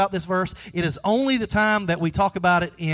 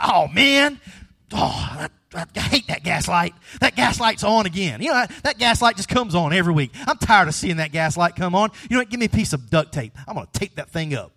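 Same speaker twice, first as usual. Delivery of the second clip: exasperated, a bit louder griping; fed-up grumbling; 2 semitones higher, 4 dB louder than usual. Retold or imitated. Driver annoyed by the gas light, exasperated griping. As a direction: gripe at the gas light, then brush it off. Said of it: "you're like".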